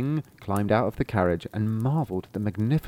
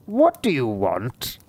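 scornful sorcerer; slower than usual. Acted. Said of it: "who looked at him scornfully".

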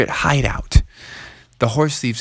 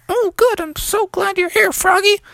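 high relieved voice; a girl glad to be saved; high happy girl's voice. Acted. Annie relieved.

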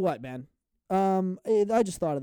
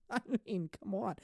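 normal talking voice

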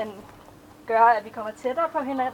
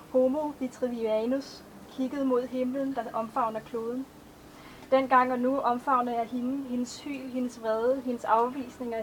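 measured, even poetry recitation; a poet reading her own verses to an audience; poem reading; 4 dB quieter than usual. Acted. Author reads her poems aloud.

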